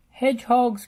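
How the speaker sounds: high, loud alarmed cry; cried out. A narrator's reading. Bob crying out as hedgehogs cross, high and loud. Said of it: "cried Bob".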